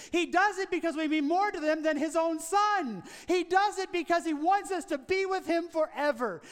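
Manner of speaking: preaching at full force. Acted. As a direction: preach it out with rising fervour.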